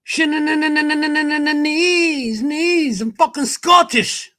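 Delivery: high, sing-song fake Scottish; terrible Scottish accent. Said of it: "that's just fucking terrible".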